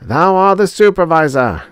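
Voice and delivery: high, loud robot proclamation; ringing, resolute pronouncement; resolute declaration. A narrator's reading. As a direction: declare it firmly to all, pitched high. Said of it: "declared two oh four resolutely".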